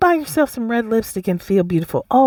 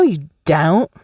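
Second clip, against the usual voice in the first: ordinary talk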